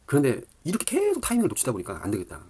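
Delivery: ordinary delivery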